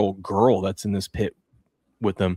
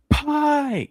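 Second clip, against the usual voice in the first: high, drawn-out happy squeal; excited child. Retold or imitated. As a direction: imitate a little kid's delighted greeting. Child greets cousin in story.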